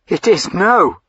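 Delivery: high, loud, ringing refusal; defiant cry. A narrator's reading. Diana refuses the duke with a ringing defiant cry. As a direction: hurl the no at him. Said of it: "she cried".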